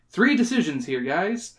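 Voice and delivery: usual speaking voice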